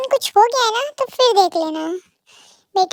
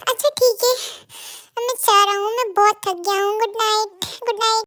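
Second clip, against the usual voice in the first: ordinary delivery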